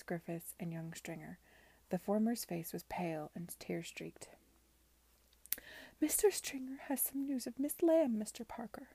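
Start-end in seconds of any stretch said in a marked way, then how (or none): 6.0-8.9 high-pitched, tremulous announcement. A narrator's reading. Landlady bringing news of missing girl, tremulous. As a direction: raise the pitch and let it quaver anxiously. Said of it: "she said tremulously".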